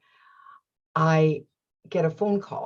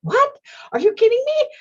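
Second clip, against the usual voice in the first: very high, loud delighted yelp; excited disbelief; 6 dB louder, faster than usual. Retold or imitated. Hearing the repair is free, very high delighted disbelief. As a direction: shriek with happy disbelief. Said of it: "I'm so excited".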